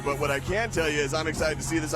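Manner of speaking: normal talking voice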